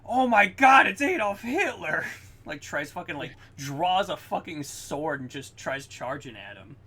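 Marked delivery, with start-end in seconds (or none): 0.0-2.0 much higher, loud shocked yell; hammed-up alarmed shout at a surprise villain; shocked exclamation. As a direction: scream it like you've seen Hitler in the car. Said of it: "he's like".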